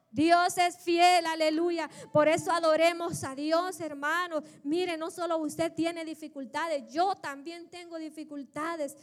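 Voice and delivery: loud, exultant proclamation, easing at the end; fervent pulpit cry; full-force preaching. Acted. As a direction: proclaim it loudly to the congregation.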